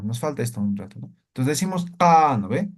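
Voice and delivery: normal talking voice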